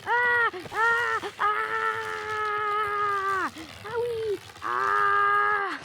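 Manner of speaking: loud, high, long screams with an "owie"; hammed-up high-pitched shrieking; mock screaming. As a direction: scream over and over in pretend pain. Activated the thing, pretend harm.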